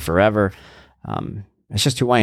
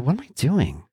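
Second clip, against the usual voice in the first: much higher, incredulous at himself; startled self-reproach; 12 semitones higher than usual. Retold or imitated. Catching himself cursing at himself, much higher and incredulous. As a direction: catch yourself mid-rant in disbelief. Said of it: "I'm like".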